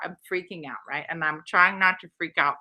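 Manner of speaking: usual speaking voice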